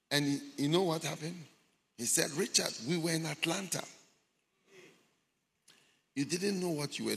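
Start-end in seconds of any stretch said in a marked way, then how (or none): none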